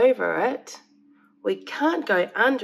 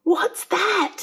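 high, sudden alarmed cry; startled; 10 semitones higher than usual. A narrator's reading. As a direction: blurt it out in alarm. Alarm at the bear.

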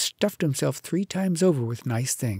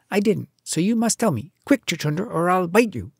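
higher, urgent threat; impatient demand; 6 semitones higher than usual. A narrator's reading. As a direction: press for an answer impatiently. Mongoose threatens the muskrat for answers.

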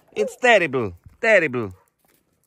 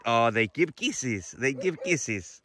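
soft cooing; 4 semitones lower, 7 dB quieter, slower than usual. Real, not acted. Cooing softly to the dogs.

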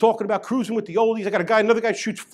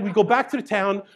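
ordinary delivery

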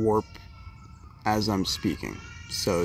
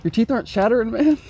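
incredulous; 12 semitones higher, 4 dB louder than usual. Real, not acted. Teasing friend who isn't cold.

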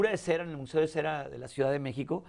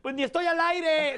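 laughing retort; 12 semitones higher, 4 dB louder than usual. Retold or imitated. His own retold retort.

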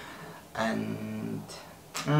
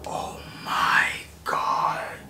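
breathless, slow, much lower whisper of awe; awed whisper; 8 semitones lower, much slower than usual. Real, not acted. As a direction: gasp it out in disbelief. First sight of new laptop.

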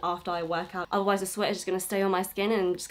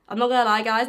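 ordinary delivery

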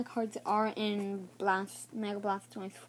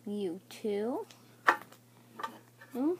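ordinary delivery

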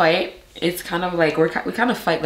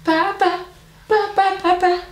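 much higher, sing-song repeating; 12 semitones higher than usual. Real, not acted. Mother coaxing her baby to say Papa, much higher baby talk.

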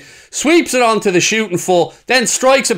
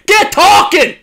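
shout; 11 semitones higher, 5 dB louder, slower than usual. Real, not acted. Yelling at defender on screen.

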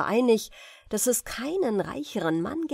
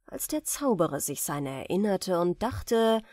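ordinary delivery

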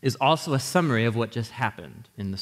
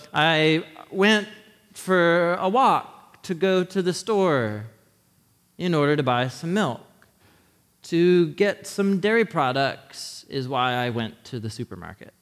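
slow made-up example; 2 semitones higher than usual. Shown as a demonstration. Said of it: "It was just a, a dumb version of it".

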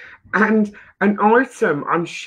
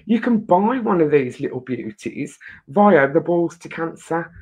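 ordinary talk, nothing marked

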